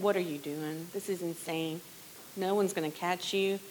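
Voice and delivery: hushed, soft inner voice of doubt. Retold or imitated. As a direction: whisper it like a nagging doubt in your head. Doubting voice in the back of head.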